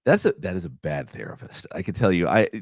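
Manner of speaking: ordinary talk, nothing marked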